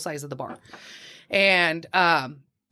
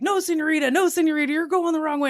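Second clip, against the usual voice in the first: higher, light imitation of an insistent tout; lightly voiced pushy local, higher; mock local tout; 7 semitones higher than usual. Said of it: "And he's like".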